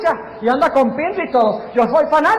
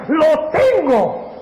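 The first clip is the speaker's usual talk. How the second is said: slow, drawn-out gloat; mock-triumphant devil, very slow; Satanás; 3 semitones higher, much slower than usual. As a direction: stretch it out like a villain claiming victory. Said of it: "Satanás dice".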